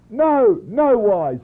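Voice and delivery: high, loud, firm refusal; apostle's resounding no, acted out; emphatic denial. Apostle's firm answer to the question.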